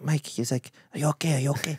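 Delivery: hushed, gentle call through the door; soft concerned asking. Retold or imitated. Flatmate hushed outside the door, checking on crying Mike. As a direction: quietly check on him, half-whispered.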